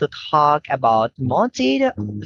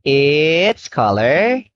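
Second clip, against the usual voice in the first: put-on reading to a toddler; slower than usual. Shown as a demonstration. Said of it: "And what color is the pen?".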